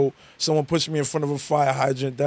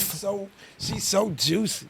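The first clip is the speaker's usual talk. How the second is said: teasing drawl; 4 semitones higher, 6 dB quieter, slower than usual. Real, not acted.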